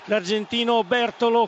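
ordinary talk

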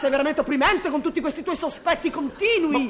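wife shouting down her husband's supposed jealousy, indignant; angry outburst. Acted. Wife escalating jealous-quarrel accusations.

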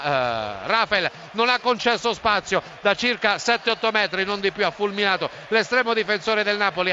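ordinary talk, nothing marked

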